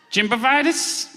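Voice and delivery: high, halting creature voice; odd gray man. Acted. Gray man explaining why he looks run down, odd high voice. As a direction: offer it up as an uncertain high question.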